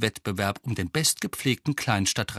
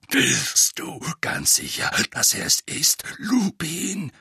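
growling Moody; 5 semitones higher, slower than usual. A narrator's reading. Moody doubting to Lupin that it is Harry, growling. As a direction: growl it out with suspicion. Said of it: "knurrte er".

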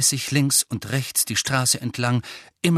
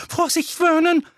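usual speaking voice

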